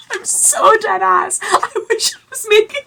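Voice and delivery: very high, helpless laughing talk; real laughter swallowing the words. Real, not acted. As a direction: squeak the words out while cracking up. Cracks up at mommy issues line.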